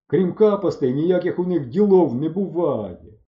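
high, drawn-out mocking tirade; scornful jeering. A narrator's reading. Bardadym running down the boys before everyone, jeering. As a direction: stretch the words with loud disdain.

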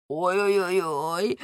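slow, drawn-out moan; mild mock wail. A narrator's reading. Lamme as they strip him, half-hearted slow wail. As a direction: lament without real volume. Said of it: "кричав він".

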